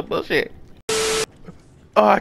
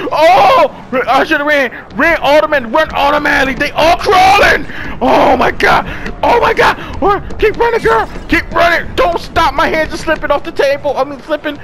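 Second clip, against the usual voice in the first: loud, high-pitched real panic; panicked shouting; 8 semitones higher, 5 dB louder than usual. Real, not acted. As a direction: scream in terror as you run for it. Fleeing crawling creatures in horror game.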